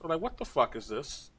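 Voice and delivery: ordinary talk, nothing marked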